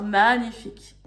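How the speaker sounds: ordinary talk, nothing marked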